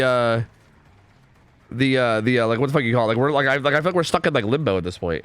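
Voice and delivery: normal talking voice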